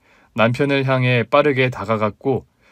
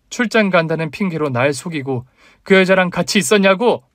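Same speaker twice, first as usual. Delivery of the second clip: higher-pitched angry accusation; shouted accusation; 6 semitones higher, 3 dB louder than usual. A narrator's reading. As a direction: raise your voice as a betrayed wife. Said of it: "똑바로 쳐다보며 다시 외쳤습니다".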